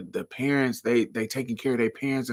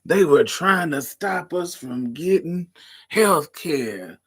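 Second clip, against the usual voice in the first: put-on slow, higher, loud old auntie lecturing; old lady voice; 5 semitones higher, 5 dB louder, slower than usual. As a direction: scold like a crotchety old aunt. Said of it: "I live for saying".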